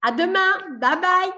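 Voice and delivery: high, loud send-off; calling goodbye. Real, not acted. Host to participants leaving the video call, calling goodbye. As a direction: sing out a cheery farewell.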